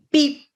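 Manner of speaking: high imitated beep; scanner beep. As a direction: chirp it like a scanner. Self-checkout scanner beep.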